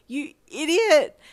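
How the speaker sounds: high, loud, drawn-out cry; exasperated outburst. A narrator's reading. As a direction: yell it in tearful exasperation. Tearful outburst at confessor.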